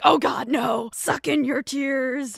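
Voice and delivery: loud mock panic, telling yourself not to cry; frantic self-pep talk. Telling herself not to cry on the bima, loud mock panic. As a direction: yell at yourself to hold the tears in. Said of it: "I remember just being like".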